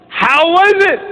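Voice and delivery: high, bright mom voice; imitating mom. Mom greeting her son at home, high and eager. Mom asking about the talk.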